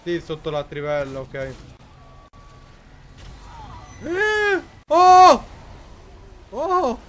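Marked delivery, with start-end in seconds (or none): 4.0-7.0 loud high startled shouts; startled yelps. Real, not acted. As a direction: jump out of your skin. Scary monster jumps out, gameplay. (urla di spavento)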